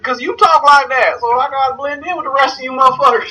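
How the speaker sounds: high, hammed-up Southern twang; mock Southern accent. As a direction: answer back in a put-on Southern accent. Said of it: "I used to always use that accent".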